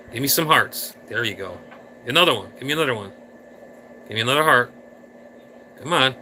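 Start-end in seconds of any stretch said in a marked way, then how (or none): none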